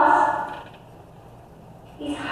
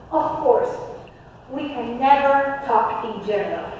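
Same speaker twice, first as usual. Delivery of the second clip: ordinary talk, nothing marked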